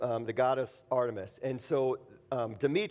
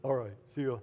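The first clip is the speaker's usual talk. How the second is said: meek deflated crowd; 4 semitones lower, 5 dB quieter than usual. Retold or imitated. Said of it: "and the crowd just like".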